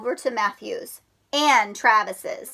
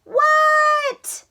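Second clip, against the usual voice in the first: Froggy shouting What; 4 dB louder than usual. Acted. Froggy voiced in story.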